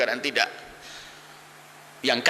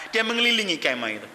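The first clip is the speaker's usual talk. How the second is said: normal talking voice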